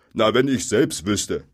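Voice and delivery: loud troll, pitch jumping up; troll's loud challenge. A narrator's reading. Bill to the captured stranger, loud demand. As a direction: demand it loudly as a baffled troll.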